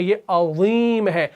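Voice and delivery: عظیم with a thick ẓa, slow; heavy ẓa. Shown as a demonstration. Teacher contrasting the Arabic عظیم, slow. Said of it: "وا کو آپ نے مفخم پڑھنا ہے".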